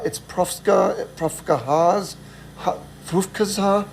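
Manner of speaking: slow, halting guesses at a foreign name; lost tourists fumbling the hotel name. Retold or imitated. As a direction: grope for the word, syllable by syllable.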